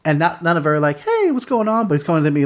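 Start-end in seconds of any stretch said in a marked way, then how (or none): 1.0-1.9 high-pitched, sing-out friendly hello; mock friendly greeting. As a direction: act out a harmless, chummy approach. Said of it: "kind of in a threatening manner".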